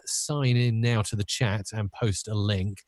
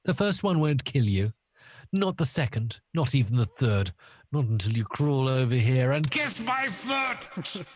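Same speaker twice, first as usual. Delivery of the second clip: raised voice building to a shouted climax; taunting, theatrical villain; Bond villain; 3 semitones higher than usual. Acted. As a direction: toy with the victim, then shriek the order. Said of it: "as if he was a Bond villain".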